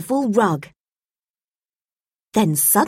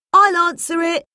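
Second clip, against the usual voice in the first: put-on higher boy's voice, eager; raised young boy voice; young Tim voice; 9 semitones higher than usual. A narrator's reading. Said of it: "Tim said".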